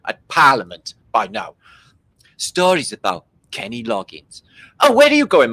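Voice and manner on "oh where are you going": high, loud mock question; mock quote. Imagined person asking Martin where he is going. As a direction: call out in a put-on voice. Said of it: "acted quote".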